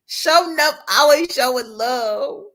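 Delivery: high, loud, drawn-out greeting; slow, sing-song praise; excited shout-out. Real, not acted. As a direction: hype up a viewer by name. Shouting out a chat viewer.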